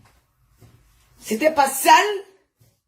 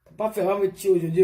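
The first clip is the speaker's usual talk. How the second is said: usual speaking voice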